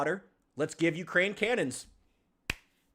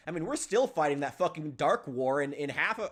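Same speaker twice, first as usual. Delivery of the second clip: normal talking voice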